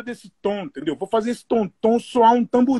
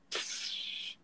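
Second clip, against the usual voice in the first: whispered long tss for a crash cymbal; vocal crash cymbal; crash hiss; 22 dB quieter than usual. Shown as a demonstration.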